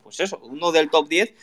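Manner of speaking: breaking-up call audio; choppy connection voice; glitchy robotic call. Real, not acted. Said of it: "Un poco mal, [name], se entrecorta".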